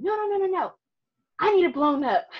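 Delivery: loud, high-pitched mock protest; upset husband. Husband stopping her calling the police, agitated imitation. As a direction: imitate a worked-up man insisting, voice jumping up. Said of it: "he was upset".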